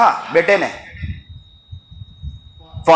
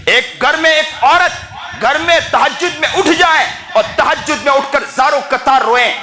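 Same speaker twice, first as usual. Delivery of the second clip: raised, emotive sermon storytelling; impassioned preaching; 4 semitones higher than usual. Real, not acted. Preacher tells congregation of a weeping mother, impassioned preaching. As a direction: preach the parable with swelling fervour.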